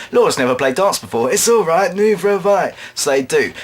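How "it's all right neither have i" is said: lightly voiced seal, higher pitch; reply as the seal in a raised voice; talking seal. Seal reassures Laura about darts, high put-on voice. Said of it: "a seal will slump onto the stage".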